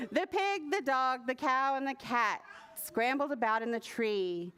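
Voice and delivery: ordinary delivery, nothing marked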